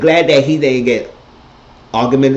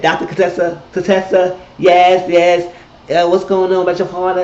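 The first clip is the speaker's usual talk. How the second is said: high, loud mock announcement into the mic; retold loud mic call, higher and louder; 9 semitones higher, 5 dB louder than usual. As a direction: belt it out like you own the microphone. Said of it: "Toya was all loud in the mic".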